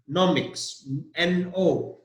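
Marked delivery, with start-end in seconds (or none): 0.1-0.9 nomics with the first syllable NO hit hard; stress on NO. Shown as a demonstration. Teacher drills the stressed syllable for students, deliberately. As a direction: punch the no in nomics. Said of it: "The stress is on".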